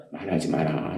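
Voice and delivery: very low, hushed command; quiet, deep divine reply; God's inner voice. Retold or imitated. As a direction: speak softly as God within. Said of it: "그럼 하나님이 제 안에서".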